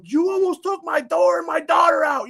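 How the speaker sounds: shouting at him, pitch way up; retold angry scream. Father retelling yelling at the driver who nearly hit his daughter, furious shout. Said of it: "So I'm screaming at the guy".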